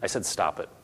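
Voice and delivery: put-on low, soft, firm order to kids; quiet, controlled parent; calm parental command. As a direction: correct a child without raising your voice. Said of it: "don't have to yell and flail at your kids".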